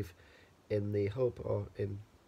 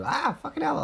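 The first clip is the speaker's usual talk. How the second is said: sudden high curse at the light; a real startled outburst; 4 dB louder than usual. Real, not acted. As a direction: jump up in pitch, caught off guard. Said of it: "I'm not swearing".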